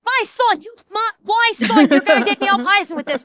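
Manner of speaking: high, hammed-up Newfoundland dialect; Newfie accent. Streamer mimicking self upset watching videos, in a Newfie accent. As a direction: let the Newfie burst out, high and indignant. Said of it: "the Newfie comes out of me".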